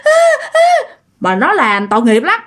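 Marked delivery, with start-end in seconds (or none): 0.0-0.9 very high, wailing imitation of a terrified little boy; imitated crying child. Terrified son crying to his mother about the ghost, imitated high wail. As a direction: cry out as a panicked child, pitch climbing to the end. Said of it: "nó khóc quá trời luôn".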